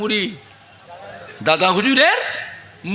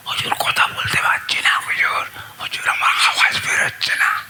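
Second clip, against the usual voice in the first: rasping near-whisper, voice gone; hoarse lost voice; 10 semitones higher than usual. Retold or imitated. Preacher pleading to his master with a ruined voice. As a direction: croak it out as if the voice is shot. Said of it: "চেঁচিয়ে চেঁচিয়ে গলা বসে গেছে".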